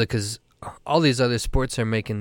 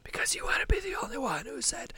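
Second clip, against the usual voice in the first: teasing hushed whisper; 10 dB quieter than usual. Real, not acted. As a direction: whisper it back playfully. Both whispering a secret prediction.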